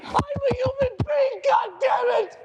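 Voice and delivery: high, shouted outburst; shouted cry of human defiance; furious yell. Acted. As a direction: scream your worth at the world. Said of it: "You've got to say".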